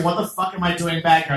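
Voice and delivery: usual speaking voice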